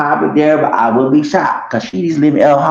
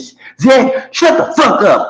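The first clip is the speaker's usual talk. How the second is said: angry shout; 11 semitones higher than usual. Retold or imitated. Telling Zach to shut up.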